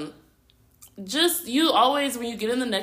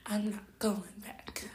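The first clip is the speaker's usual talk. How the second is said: hushed whisper; 14 dB quieter than usual. Retold or imitated. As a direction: breathe it under your breath. Whispered quoted line.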